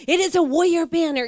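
high and loud warrior banner, mock broken English; emphatic proclamation. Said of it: "with a broken English".

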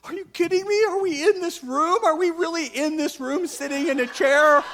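high-pitched, mock-indignant evangelist; incredulous evangelist. Preacher imitating evangelists stuck sitting in church, incredulous. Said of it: "they're like".

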